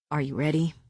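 low, soft question; quiet man's voice. A narrator's reading. Man to his mate before battle, quietly. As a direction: ask it low and hushed.

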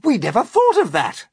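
loud, high burst of realisation; crying out. A narrator's reading. Crew realising James is right, crying out. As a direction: exclaim it at full voice. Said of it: "they cried".

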